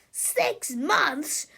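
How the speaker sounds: loud, high shocked outburst; outraged scream. A narrator's reading. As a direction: yell it in disbelief. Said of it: "screamed Cruppy the Kid".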